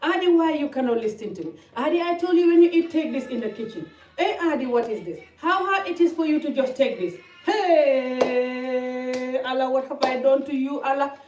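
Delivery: loud, high mock nagging; exasperated scolding. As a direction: act out scolding your child. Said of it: "I'll talk like this, yeah?".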